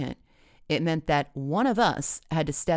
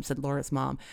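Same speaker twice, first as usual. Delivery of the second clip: ordinary delivery, nothing marked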